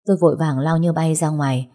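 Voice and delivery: ordinary delivery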